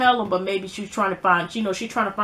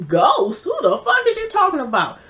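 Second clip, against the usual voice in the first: incredulous scoff; 6 semitones higher, 3 dB louder than usual. Retold or imitated. Holly scoffs at Angie.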